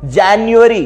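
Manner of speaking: जनवरी said d͡ʒɛnjuəri, with a clear ju; teacher models जनवरी as jen-yu-eri; IPA-style January. Shown as a demonstration. Teacher modelling जनवरी with a clear yu sound. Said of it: "actually पढ़ते क्या है इसमें".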